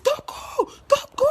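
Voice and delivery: very high, stretched-out shriek; imitating screaming friends. Friends calling her back from the road, mock panicked scream. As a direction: scream the name after a runaway. Friends yell as she runs off.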